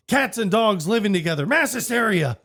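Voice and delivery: high, loud mock-dramatic doom. Pete warning the mayor, high loud mock doom. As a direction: ham up the end-of-the-world list.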